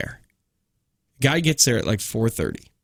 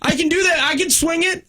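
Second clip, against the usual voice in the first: high, light retold reassurance; high-pitched appeasing. Caller agreeing to driver's price, high-pitched appeasing. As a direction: placate him, pitched right up.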